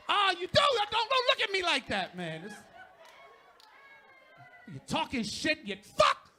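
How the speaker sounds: high, loud playful jab; loud teasing at a laughing fan; teasing shout. Real, not acted. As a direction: call out the audience member. Teasing audience member.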